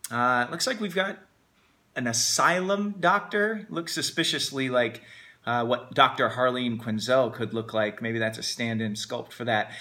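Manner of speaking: ordinary talk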